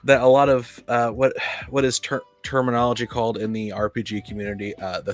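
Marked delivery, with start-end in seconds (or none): none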